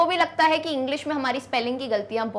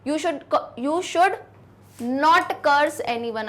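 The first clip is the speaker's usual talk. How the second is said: very slow dictation; dictating an example sentence; 2 semitones higher, much slower than usual. Shown as a demonstration. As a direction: drag each word out for copying. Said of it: "जैसे".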